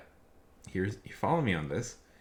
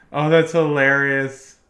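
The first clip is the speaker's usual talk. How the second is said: amused delight; 6 semitones higher, 13 dB louder, slower than usual. Real, not acted. Amused reaction.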